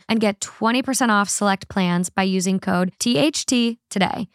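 upbeat, loud ad read voice. Acted. Sponsor ad read.

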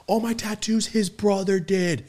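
excited realization. Retold or imitated. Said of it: "And he's like".

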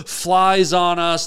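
usual speaking voice

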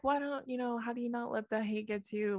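hushed mock-earnest question; imitated timid questioner. Voicing people who ask her.

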